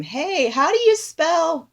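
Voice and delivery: high, drawn-out yell across the house; yelled question, slow and high; retold yell. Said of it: "like, yelling at him".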